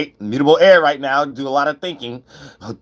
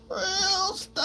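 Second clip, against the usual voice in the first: very high, soft "Real stuff"; high catchphrase; 10 dB quieter than usual. Real, not acted. Signature catchphrase.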